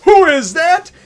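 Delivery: high, very loud cry of astonishment; amazed shout. Retold or imitated. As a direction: shout the question in hammed-up amazement. Acts out amazed cry at reveal.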